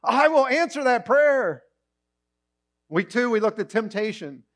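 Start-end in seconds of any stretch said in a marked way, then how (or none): 0.0-1.6 higher, slow delighted yes; eager yes. Retold or imitated. God gladly answering a prayer for wisdom. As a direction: promise it gladly. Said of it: "God's going".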